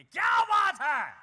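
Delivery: high, loud excited praise; Hindi fan's cheer. Retold or imitated. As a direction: cheer like a thrilled Hindi-speaking fan. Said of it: "મને કે". (હિન્દીભાષી ચાહકની ઉત્સાહી વાહવાહ)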